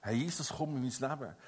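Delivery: tender, quiet prayer; soft prayer. Shown as a demonstration. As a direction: pray it gently and quietly. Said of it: "Wenn ein Mensch das Gebet spricht".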